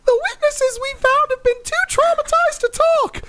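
very high squeaky cartoon mouse; Disney Mouse voice. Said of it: "been the Disney Mouse".

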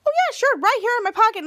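high-pitched mock agreement; sarcastic. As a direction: play along with exaggerated fake helpfulness. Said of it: "who's sarcasm".

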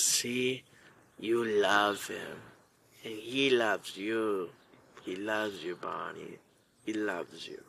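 low, slow, hushed crooning; soothing imitation. Teller as camp kid petting the horse, soothing imitation. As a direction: coax softly, drawing out each short phrase.

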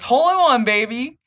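loud, high gloat; gloating quip. Retold or imitated. Hellboy gloating after his coin shot, loud and triumphant. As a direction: crow about the perfect shot.

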